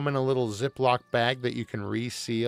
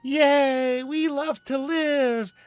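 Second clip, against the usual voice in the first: much higher, slow gushing commercial voice; cheesy ad customers; 5 dB louder, slower than usual. Pretend. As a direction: chirp it like happy people in a TV ad. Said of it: "It's a commercial".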